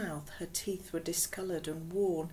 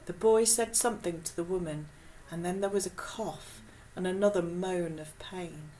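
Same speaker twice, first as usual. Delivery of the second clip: usual speaking voice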